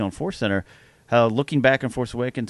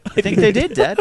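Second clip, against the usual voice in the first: amused; 11 semitones higher, 6 dB louder than usual. Real, not acted. Replying to dad, amused.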